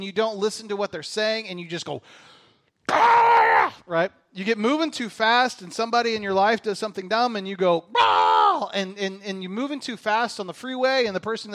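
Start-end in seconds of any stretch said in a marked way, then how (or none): 2.9-3.7 high, loud wordless "Ah!"; hammed-up scream of frustration; exasperated yell. Parent snapping at kids when rushed, exasperated yell. 8.0-8.7 loud, drawn-out wordless "Ah!"; mock roar of frustration; exasperated yell. Reacting to somebody doing something dumb, exasperated yell.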